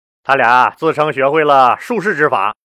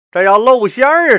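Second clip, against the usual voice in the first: higher, worried aside; flustered conjurer; 8 semitones higher than usual. A narrator's reading. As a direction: fret that the trick will fail. Conjurer panics at being exposed.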